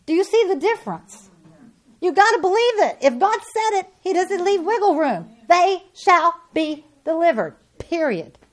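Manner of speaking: high, loud preacher's cry; full-force preaching. Acted.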